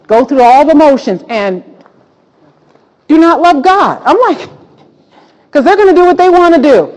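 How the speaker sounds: rising to a high, loud preaching pitch. Real, not acted. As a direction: build it up into a shout from the pulpit. Preacher rising to full force.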